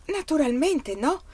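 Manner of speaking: high, slow emphatic protest; alarmed denial, pitched well up; alarmed exclamation. A narrator's reading. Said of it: "esclamò Anna allarmata".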